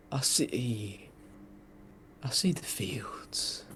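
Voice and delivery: faint breathy last words; dying whisper. Acted. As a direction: whisper it as life fades. Dying man's final whisper.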